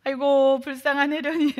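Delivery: half-joking moan of self-pity; mock self-pity wail. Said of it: "자기 연민에 빠져가지고".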